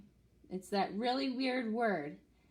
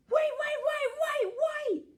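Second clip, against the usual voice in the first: very high, loud shouting; scream; 5 dB louder than usual. Acted. Animals screaming at the dingo to stop, very high and loud. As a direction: yell to stop him. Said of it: "scream all of the animals".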